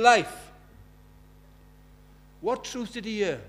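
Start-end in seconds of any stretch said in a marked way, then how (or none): 0.0-0.5 loud, high cry of "Life!"; shouting. Retold or imitated. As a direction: call out "Life!" with everything. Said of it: "he's shouting".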